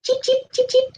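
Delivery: very high little cheeps; short, high-pitched bird calls. Shown as a demonstration. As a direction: cheep like a tiny chick. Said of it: "We might hear them say".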